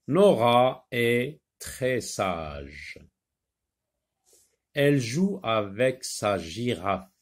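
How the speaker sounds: slow, careful storybook reading; unhurried reading-lesson voice; reading to children. Acted. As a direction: read it slowly to young pupils. Reads the short story aloud.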